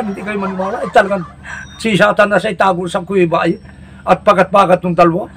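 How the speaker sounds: ordinary talk, nothing marked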